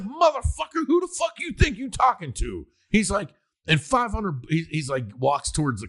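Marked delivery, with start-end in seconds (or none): 0.0-2.7 high, shouted rage, retold from the film; mock furious yell; angry yelled quote. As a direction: bellow it like a furious pimp. Retold movie pimp yelling.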